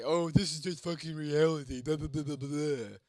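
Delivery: loud, high sneering imitation of a cynic; mock the people who call it reality; hammed-up whiny doubter voice; mocking naysayer. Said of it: "other people bring you down and say".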